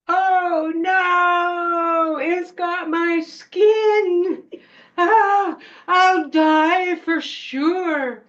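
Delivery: high, loud, slow mock wail; hammed-up doomed yell. Child fooling the witch with a coat, theatrical screaming. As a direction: melodramatic shriek, every word stretched. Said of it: "and I yelled".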